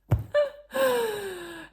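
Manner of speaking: high-pitched giggle at herself. Real, not acted. Laughing at how she greeted the clerk, high giggle. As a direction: laugh in a squeal.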